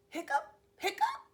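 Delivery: high, loud squeaky hiccup sounds. Acted. Reading a story to kindergarteners, high loud hiccups. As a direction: hiccup it out like a big dog.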